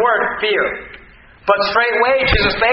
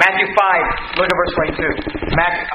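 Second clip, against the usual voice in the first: normal talking voice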